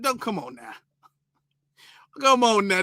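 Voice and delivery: ordinary talk, nothing marked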